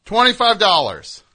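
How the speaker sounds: high, loud prize reveal; excited announcement. Real, not acted. Announcing donation amount loudly.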